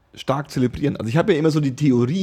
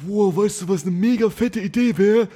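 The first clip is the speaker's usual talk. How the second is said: excited stoner idea; 7 semitones higher than usual. Retold or imitated. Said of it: "dann sagst du so".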